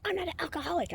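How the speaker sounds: mocking the guy's denial; mock defensive guy. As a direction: imitate him protesting. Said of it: "He's like".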